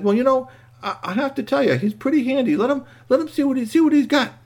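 put-on very high boy's voice; boy vouching for robot. Boy urging his hurt friend to accept help, persuasive. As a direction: plead the case up high.

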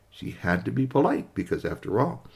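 usual speaking voice

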